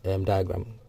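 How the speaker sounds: usual speaking voice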